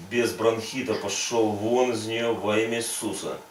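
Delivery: normal talking voice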